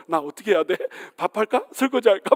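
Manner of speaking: mock panic, eager to appease; flustered husband. Husband anxiously offering chores to his scolding wife, flustered. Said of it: "앉지도 못하고 서지도 못하고".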